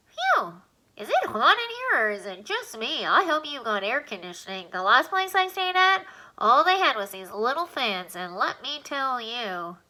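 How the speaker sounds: a cheeky tenant complaining; squeaky alien, chatty. Acted. Alien in Alan's nose grumbles about the heat, squeaky and chatty.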